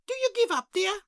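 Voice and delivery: high, sing-song dearie voice; a chirpy shopkeeper greeting at the bell. Acted. Shopkeeper greets a customer, high and sing-song.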